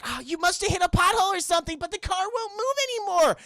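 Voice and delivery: high, quick airhead voice; mock ditzy Jenny. Mocking Jenny about the pothole, high and ditzy. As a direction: rattle it off like a clueless girl. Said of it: "Jenny's just saying".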